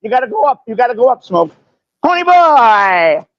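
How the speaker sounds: a teasing caricature; mocking put-on voice. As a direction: drag out the name to tease him.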